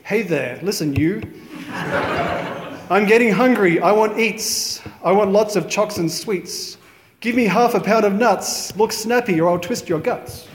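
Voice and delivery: ordinary talk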